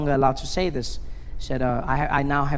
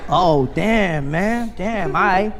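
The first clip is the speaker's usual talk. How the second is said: mock awe for the motherland; hyped-up admiration; 3 semitones higher, 4 dB louder than usual. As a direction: gush like he just met a legend. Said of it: "comedian gushing about Africa".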